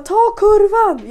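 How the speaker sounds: high, loud, slow shout; a guy yelling across the slope; shouted warning. Retold or imitated. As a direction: yell "Ta kurvan!" down the hill.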